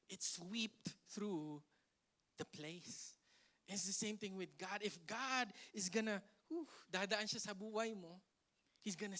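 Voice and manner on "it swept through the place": slow dramatic telling. Acted. Storm sweeping through, told dramatically.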